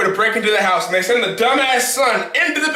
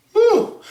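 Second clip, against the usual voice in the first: high whew sigh; 12 semitones higher than usual. Real, not acted. Sigh after boast.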